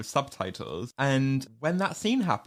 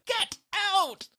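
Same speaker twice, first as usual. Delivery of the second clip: high, sharp hammed-up Get out; mock yell. Harriet ordering the first year out, hammed-up yell. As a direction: bark it like a bossy head girl.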